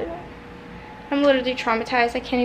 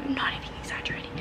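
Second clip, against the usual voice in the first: fast, soft whispered "I'm not even exaggerating"; 11 dB quieter, much faster than usual. Real, not acted. She repeats to viewers that she isn't exaggerating, whispering. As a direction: whisper it to yourself.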